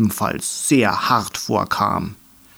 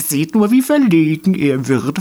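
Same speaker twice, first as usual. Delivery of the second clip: teasing line in a lightly put-on voice; smirking tease; 5 semitones higher, 3 dB louder than usual. A narrator's reading. A dandelion teases the blushing grumbler, smirking. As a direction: needle him with a grin. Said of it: "feixte einer".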